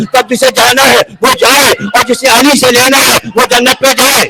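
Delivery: high, driving sermon delivery building in volume; preaching at full force. Real, not acted. Preacher warning the congregation about going astray, rising full-force preaching. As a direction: build link by link to a ringing climax.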